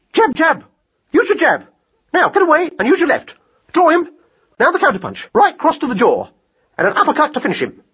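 high, excitable ringside coaching; mad boxing hare. Acted. Hare shadow-boxes in a field, excitable and high. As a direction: call out punches, pitched high.

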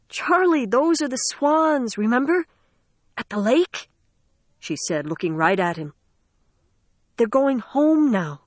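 urgent, high pleading; pleading girl voice. Acted. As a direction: plead with him to remember.

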